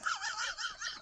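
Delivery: a tiny high-pitched squeal of laughter; high squeaky giggle. Real, not acted. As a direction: let a giggle escape, way up high. Giggling at a rude pun.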